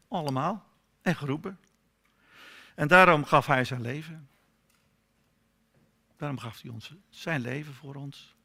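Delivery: ordinary talk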